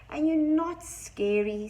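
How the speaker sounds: loud, high and drawn out; emphatic challenge. A narrator's reading. Owl tells the little monster off, emphatic challenge.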